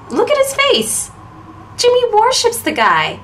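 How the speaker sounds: high-pitched incredulous mockery; gleeful teasing. Girl mocks her friend's idolizing, gleeful and high. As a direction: tease him for his hero worship.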